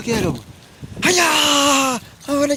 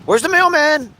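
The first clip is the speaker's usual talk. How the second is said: excited dog baiting; 8 semitones higher than usual. Acted. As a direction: hype the dog up, high and eager.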